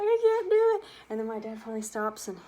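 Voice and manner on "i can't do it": loud, high, desperate cry; scared child. Retold or imitated. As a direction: refuse with all you've got. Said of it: "I'm like".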